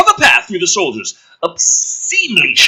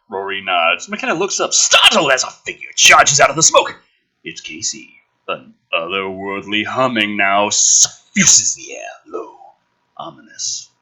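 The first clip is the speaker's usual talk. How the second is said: low ominous narration; 9 semitones lower than usual. Acted. Reading the father's arrival from the script, low narration. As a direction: murmur the scene with menace. Reads screenplay action aloud.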